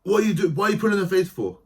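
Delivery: loud, high mock yell; mock shout. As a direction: bark it like a riled youth leader. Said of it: "She would just be".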